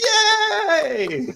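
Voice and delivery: very high, loud drawn-out cheer; imitated gleeful child. Baby girl happy after her shot, imitated gleeful cheer. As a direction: squeal it like a delighted toddler.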